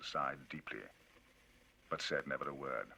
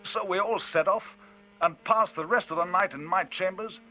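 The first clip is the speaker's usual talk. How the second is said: higher, louder, lively telling; Enfield, indignant storyteller; 12 semitones higher, 11 dB louder than usual. Acted. Enfield telling Utterson of the cheque, indignant and loud. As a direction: drive the tale on, indignant.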